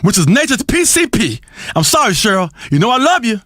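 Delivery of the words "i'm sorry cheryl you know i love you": usual speaking voice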